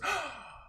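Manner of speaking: high startled gasp; sharp gasp. Acted. The girl gasps as she squeezes the door handle. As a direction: gasp in sudden alarm.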